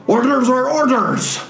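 high-pitched dwarven call down; dwarf. Acted. Dwarf answering through the hole, high dwarven call. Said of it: "a dwarven head ... look down at you and go".

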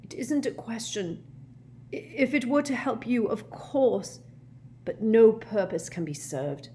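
flustered professor. A narrator's reading. Slughorn squirms, makes excuses.

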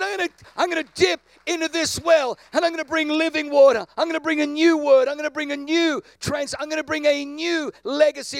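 determined declaration. Retold or imitated. Pastor retelling his vow for his kids as a loud declaration. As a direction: shout the vow to break the pattern. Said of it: "And I said".

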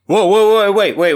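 high, loud call to halt; loud whoa. Real, not acted. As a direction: stop everyone, loud and high. Interrupting to stop everyone.

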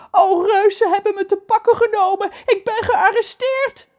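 very high, loud dramatic lament; dramatic mermaid. Acted. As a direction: ham up the arrest. Trudy dramatisch. (dramatische zeemeermin)

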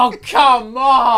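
high, loud, slow mock outrage; costumed tree actor complaining about his ruined moment; frustrated protest.

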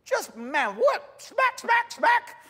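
cartoonish outrage with slaps; outraged Pharaoh. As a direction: play the slapped-in-the-face Pharaoh, shrieking. Pharaoh acted as outraged, slapping.

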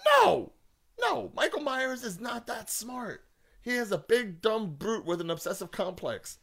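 high-pitched rant with a shouted opening; exasperated rant. Real, not acted. Fan objecting that the killer isn't smart, exasperated rant. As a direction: burst out high and loud, then keep protesting. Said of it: "Sorry, I'm ranting".

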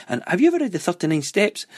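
lightly acted keen grandmother; excited grandma. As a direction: press a favourite book on a grandchild, voice leaping up. Acting grandma offering books.

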